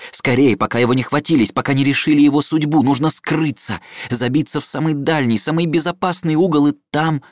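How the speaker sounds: rising panic as he runs; urgent rush. A narrator's reading. Narration of frantic running.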